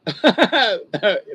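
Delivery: big hearty burst of laughter; loud real laughter. Real, not acted. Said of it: "Mark, that's funny".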